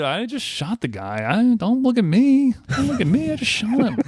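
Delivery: high-pitched, hammed-up "who, me?" denial; mock innocent protest. Host plays the shooter excusing himself, mock innocent.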